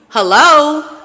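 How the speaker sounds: loud, high call of "Hello?"; Spirit calling. Acted. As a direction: Spirit knocking on your head. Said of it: "Spirit is like".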